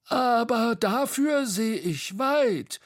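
high, slow, drawn-out call; delighted call. A narrator's reading. Bo calling from atop Tete, high and delighted. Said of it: "rief Bo".

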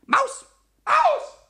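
short, very high, loud calls; urgent shouting. Acted. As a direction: shout after the runaway mouse. Yelling after the fled mouse. (lautes, hohes Rufen)

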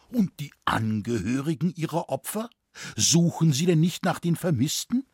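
touch of roughness in an appalled question; hoarse voice. A narrator's reading. Scholar asks about the victims' families, slightly hoarse. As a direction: ask it a little hoarsely, pitch climbing. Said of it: "fragte der Studienrat mit rauer Stimme".

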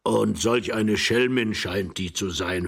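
put-on slow, loud old man's voice; rambling old man. Old husband continuing about the lady, slow and doddering. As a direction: relish the gossip slowly.

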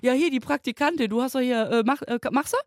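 mock panicked colleagues asking the intern; startled colleagues. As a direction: act out the whole office gasping in surprise. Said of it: "Und alle so".

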